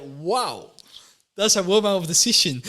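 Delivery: impressed and tickled by her boldness; amazed exclaim. Retold or imitated. Amazed reaction to wife's ultimatum.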